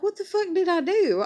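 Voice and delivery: high, loud disbelieving cry; retold indignant yell; indignant outburst. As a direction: shoot back in indignant disbelief. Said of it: "And I was like".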